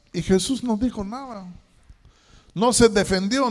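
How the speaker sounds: normal talking voice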